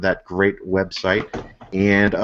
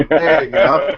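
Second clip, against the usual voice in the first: laughing; 6 semitones higher, 5 dB louder than usual. Real, not acted. Laughing at the dog joke.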